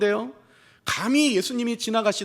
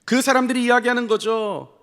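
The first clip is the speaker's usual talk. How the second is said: ordinary talk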